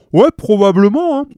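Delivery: loud, high table agreement; thick-voiced policeman. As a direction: agree loudly through food. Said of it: "de leurs grosses voix pâteuses".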